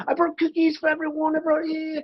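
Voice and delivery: put-on high British accent, chirpy; British accent, offering cookies. Actor imitating Florence offering cookies in a British accent. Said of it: "And she brought cookies".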